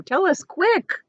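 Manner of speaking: high, slow urgent plea; impatient. Acted. Earthworm pressing James, high and urgent. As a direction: urge him anxiously.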